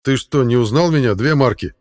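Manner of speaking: loud, amazed teasing question. A narrator's reading. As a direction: tease him in amazement. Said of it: "с изумлением спросил Хаук".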